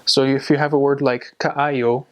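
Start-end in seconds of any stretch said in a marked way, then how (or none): 1.4-2.1 "kaayo" stressed on the middle "a-yo" vowel, said "ai"; stress on second syllable. Shown as a demonstration. Teacher showing a learner the Cebuano word "kaayo", stress on second syllable. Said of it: "the emphasis is on the I".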